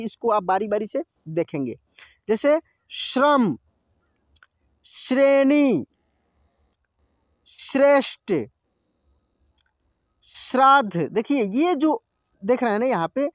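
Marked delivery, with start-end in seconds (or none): 2.3-11.0 each word spoken slowly and clearly on its own; drilling श्रम, श्रेणी, श्रेष्ठ one by one for the class. Shown as a demonstration. Hindi teacher to pupils, careful drill pronunciation. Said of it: "मैं प्रयास कर रहा हूँ ना कि आप इसको उच्चारण करें मेरे साथ में".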